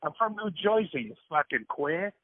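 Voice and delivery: mock Jersey accent; New Jersey accent. Shown as a demonstration. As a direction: do a rude New Jersey local. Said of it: "freaking Jersey".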